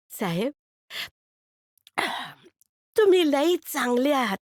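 put-on high kid's voice, stress on तुम्ही; a street boy touched by kindness; grateful boy. Boy telling the man he is very good, high and grateful.

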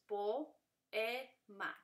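po, e, ma as three separate syllables; split into syllables. Shown as a demonstration. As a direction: separate po, e, ma slowly and evenly. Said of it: "Dividan las sílabas". (po, e, ma sílaba por sílaba)

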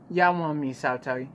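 ordinary delivery

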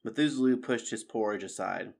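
plain reading voice

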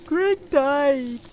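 loud, high cry of dismay; anguished shout. Real, not acted. Player yelling as teammate Greg dies, loud anguished cry. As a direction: scream it as your friend goes down.

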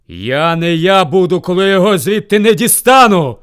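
loud vow building in force; roaring bear. A narrator's reading. Bear threatens rival in the well, roaring. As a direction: swear with full-throated fury. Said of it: "як рикне з цілого ведмежого горла".

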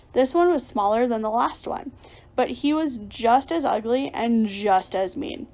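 ordinary delivery, nothing marked

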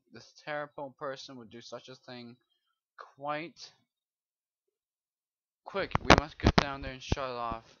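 lightly voiced wizard reading an urgent warning; understated storyteller voice, rising to a loud cry on the call to action; dramatic quest reading. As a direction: read the lore like an old mage. Reads the in-game quest text aloud.

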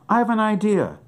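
light up with a brainstorm; excited about his plan; sudden idea. Acted.

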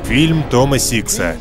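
movie trailer voice. Acted. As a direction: build hype like a trailer. Trailer narrator credits the director.

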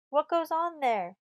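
loud, high, slow shout. A narrator's reading. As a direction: holler a challenge across the fort. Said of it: "La Violette's shout from the opposite bastion".

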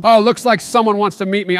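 ordinary delivery

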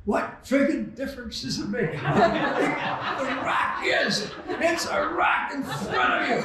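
high, laughing mock yell at the rock; imitating a friend's exasperated shout through laughter; retold yell. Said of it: "He yelled back".

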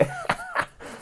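a sudden shrill giggle into the phone; high squealing laugh. Acted. Caller on the phone laughing, high squealing giggle.

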